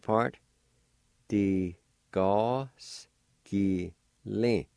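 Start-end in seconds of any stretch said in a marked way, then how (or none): 1.3-4.7 teacher slowly modelling Di ga ski li. Shown as a demonstration. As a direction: Di ga ski li drawn out very slowly, syllable by syllable. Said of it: "This one's pronounced like this".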